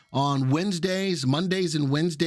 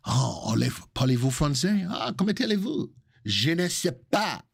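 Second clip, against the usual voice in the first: mock French accent; 2 semitones higher than usual. Host showing off his French to viewers, mock French accent. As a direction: play the Frenchman with phrasebook French. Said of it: "that's my French".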